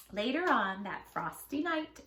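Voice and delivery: ordinary delivery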